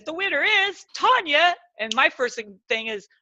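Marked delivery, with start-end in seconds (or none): 0.0-1.5 announcer. Retold or imitated. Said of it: "And then she says".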